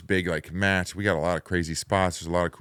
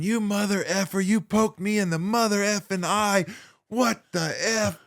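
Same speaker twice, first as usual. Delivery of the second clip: high-pitched imitation of furious cussing; high, strained angry tirade; laying into someone; 11 semitones higher than usual. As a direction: chew them out in mock outrage. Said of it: "he's laying it in on me".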